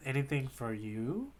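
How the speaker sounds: ordinary talk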